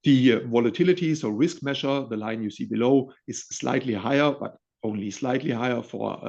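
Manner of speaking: usual speaking voice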